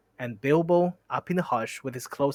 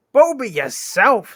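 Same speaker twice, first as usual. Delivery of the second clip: high, loud, drawn-out retort; quarrelling troll shout; indignant comeback; 9 semitones higher, 11 dB louder, slower than usual. Acted. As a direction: throw the insult back, stretched out. Tom throws insult back.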